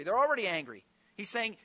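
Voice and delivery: normal talking voice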